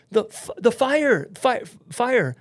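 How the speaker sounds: high, loud, stuttering alarm; panicked stammer. Retold or imitated. Passenger to stewardess after falling, panicked stammer.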